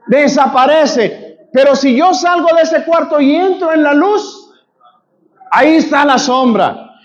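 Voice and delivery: impassioned sermon, rising to shouts; preaching. Acted. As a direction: preach it hard to the congregation. Preacher teaching with call and response. (predicando con fervor, a gritos)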